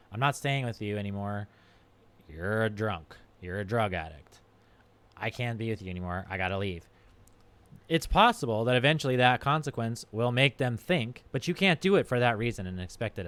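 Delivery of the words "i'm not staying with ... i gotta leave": low, soft, resigned ultimatum; quiet resolve. Acted. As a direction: tell a partner it's over, quietly and low. Acted breakup with an addicted partner.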